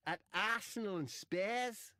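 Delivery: high, slow, loud bad Scouse; Wade Rooney. Comic carries on as Wade after being corrected, bad Scouse. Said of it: "that's not Scouse".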